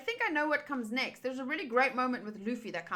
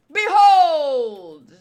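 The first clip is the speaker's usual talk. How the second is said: much louder booming call; grand proclamation; 3 semitones higher, 14 dB louder than usual. Acted. Villain king unveiling his fused warrior, loud call. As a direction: present the fusion with a flourish.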